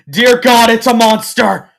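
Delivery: high, very loud panicked shout; panicked outburst. A narrator's reading. Filly decides the stranger is a monster, screaming panic. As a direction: shriek it in sudden horror. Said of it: "her mind racing with".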